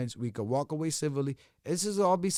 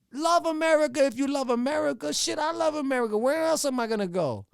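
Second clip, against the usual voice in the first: heated outburst; 8 semitones higher than usual. Real, not acted. Heated outburst about loving America.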